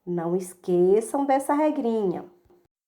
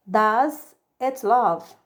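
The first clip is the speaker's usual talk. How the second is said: slow drill; much slower than usual. Shown as a demonstration.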